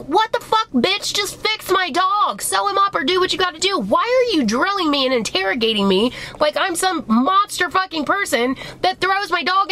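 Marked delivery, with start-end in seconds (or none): 0.0-3.4 much higher, fed-up indignant rant; exasperated outburst. Retold or imitated. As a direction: blow up in disbelief at the vet. Venting at the rude vet.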